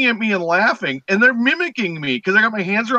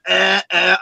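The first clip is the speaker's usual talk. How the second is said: Joan Rivers choking impression. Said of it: "she goes".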